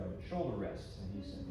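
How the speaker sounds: usual speaking voice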